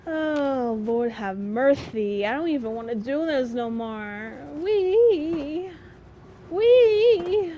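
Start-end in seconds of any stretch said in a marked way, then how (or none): 4.5-7.5 high sarcastic whee, second one louder; a flat-joy fake cheer, pitched up; mock wee. Mock cheer while playing.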